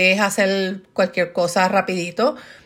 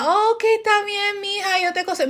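high, soft, mock-sweet mom; mockingly tender, much higher voice; indulgent mother; 9 semitones higher, 3 dB quieter than usual. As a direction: imitate a pushover mother, sugary and high.

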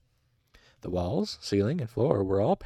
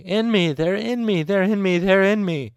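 high, loud frantic repeated scream; panicked screaming; 11 semitones higher, 10 dB louder than usual. A narrator's reading. Restrained patient screaming into the hallway, in frantic panic. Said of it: "Panicked screaming burst into the hallway".

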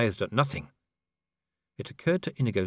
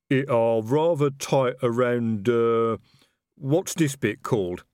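slow, slightly louder troll voice; a troll fumbling for words in his suit; 3 semitones higher, 4 dB louder, slower than usual. A narrator's reading.

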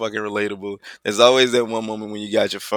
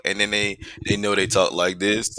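ordinary delivery

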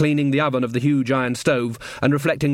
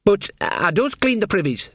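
higher-voiced servant's mild protest; reasonable; 8 semitones higher than usual. A narrator's reading. Servant answers the queen's threat, reasonable. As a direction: point it out innocently and reasonably. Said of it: "said Sean in a reasonable voice".

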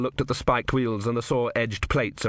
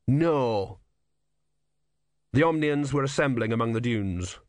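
usual speaking voice

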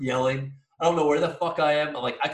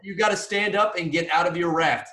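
yelling a warning up the river; shouting at the rafters to move; retold yelling; 3 semitones higher, 5 dB louder than usual. Kayaker yelling at the blocking rafters to move.

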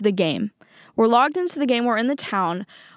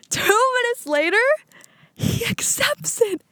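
astonished reveal; 12 semitones higher than usual. Retold or imitated. Revealing to viewers that he accepted, astonished. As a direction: pitch it way up and pause before the twist.

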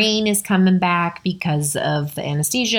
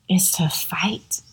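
whispered, slow, choking up; tearful whisper as she admits it; choked up; slower than usual. Real, not acted. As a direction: let the voice catch and fall away. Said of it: "And I still get choked up".

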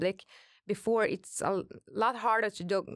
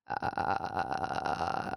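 low creaky fry demo; vocal fry; 13 semitones lower, 3 dB quieter than usual. Shown as a demonstration. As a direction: let the voice crackle and fry. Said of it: "it's like this".

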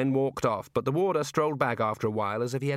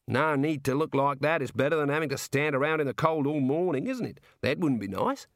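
jovial Aussie warder; 5 semitones higher than usual. Acted. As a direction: play a chummy outback prison guard. Warder consoles condemned man.

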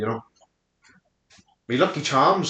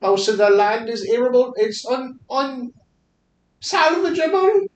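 mock Chinese accent; 7 semitones higher than usual. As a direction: lecture in a hammed-up Chinese accent. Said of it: "we gotta go back to the accents".

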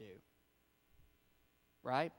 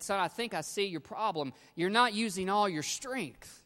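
raised, higher father's voice calling to his son; 7 semitones higher, 7 dB louder than usual. Retold or imitated. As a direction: call out to the boy from the truck. Father calls to son in story.

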